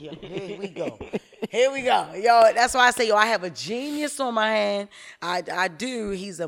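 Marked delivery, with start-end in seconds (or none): 0.0-4.9 louder, higher amused groan; laughing exasperation. Real, not acted. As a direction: laugh it off, knowing you got played. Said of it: "I'm like".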